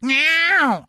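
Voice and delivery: a sudden high, loud cat meow; mock meow. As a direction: yelp it like a startled cat. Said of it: "he's like".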